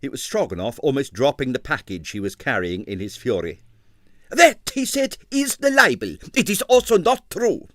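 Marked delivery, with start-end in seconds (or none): 4.3-7.7 high, loud furious outburst; outraged impresario screaming at the slander; raging. Acted. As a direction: shriek it in outraged fury. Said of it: "Another scream of rage".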